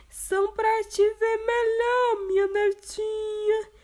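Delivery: wolf disguising voice. Acted. Wolf posing as grandma, disguised voice. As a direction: pass yourself off as grandma.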